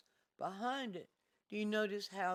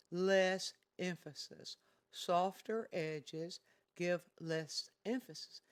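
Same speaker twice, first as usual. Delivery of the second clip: less emphasis said slowly and louder; slow emphatic rule; 3 dB louder, slower than usual. Shown as a demonstration. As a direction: lean on less. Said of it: "teacher stresses rule".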